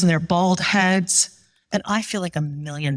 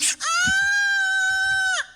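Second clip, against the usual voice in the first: long, very high mock scream; child's scream. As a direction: imitate a terrified kid's shriek. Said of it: "Noah's like".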